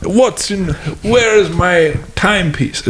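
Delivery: a hammed-up British accent, way up high; mock Sherlock Holmes. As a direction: call for Watson grandly, drawn out. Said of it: "British accent".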